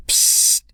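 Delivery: loud unvoiced psst. Hidden pink Yoshi calling them over, loud psst. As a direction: hiss to get their attention.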